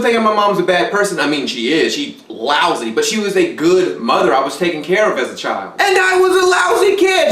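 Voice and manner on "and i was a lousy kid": much higher, loud, jokey yell; loud outburst. Real, not acted. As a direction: blurt it out loud and high.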